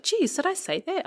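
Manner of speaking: mum's high-pitched surprised reply; mock surprise. Retelling mother's surprised reply.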